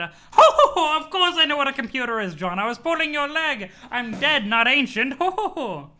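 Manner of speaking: high cackling granny teasing; cheeky grandma laughing at her joke; Nana voice. Acted. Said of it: "You love my Nana voice?".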